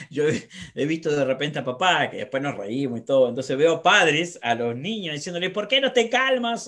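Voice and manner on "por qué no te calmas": higher, louder mock yell at a child; mock shouting parent; imitated yelling parent. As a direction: shout it like an exasperated dad. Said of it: "gritándole".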